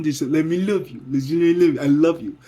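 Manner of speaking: loud begging to let her love him; pleading. Acted. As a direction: press it on him, swelling.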